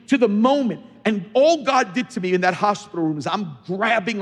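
loud, high, fervent sermon voice; full-force preaching. Acted. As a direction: preach it out with everything.

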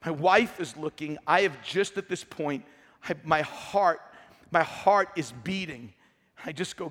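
usual speaking voice

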